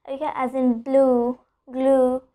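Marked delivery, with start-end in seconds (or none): none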